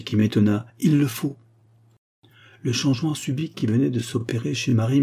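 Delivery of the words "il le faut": firm, higher-pitched command; energetic, commanding tone; imperative. A narrator's reading. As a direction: insist with energy, pitch lifted. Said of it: "d'un ton impératif, avec une énergie".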